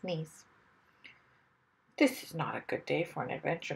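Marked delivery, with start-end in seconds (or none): 2.0-3.7 low, soft, quick murmur to himself; sad. A narrator's reading. Mister Wumble to himself in the ditch, sad and low. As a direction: grumble sadly under your breath. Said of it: "said to himself sadly".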